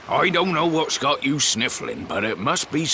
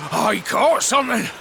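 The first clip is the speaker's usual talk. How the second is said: excited catch; 7 semitones higher than usual. Acted. Fisherman lands a catch.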